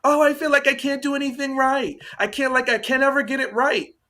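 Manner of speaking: high, whiny self-pity, imitating her; mock deflecting whine. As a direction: mimic her helpless deflecting complaint. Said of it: "all she does is deflect".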